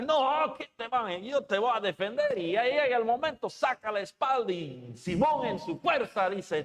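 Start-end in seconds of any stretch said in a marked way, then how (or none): 0.0-2.4 much higher, louder vow to defend; Peter's outburst. Retold or imitated. Peter promises to defend Jesus, loud and high. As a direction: burst out in loyal defiance.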